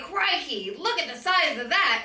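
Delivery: loud, high mock Australian narrator; mock Australian marvelling; Australian accent. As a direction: ham up the excited Australian host. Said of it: "But he's like".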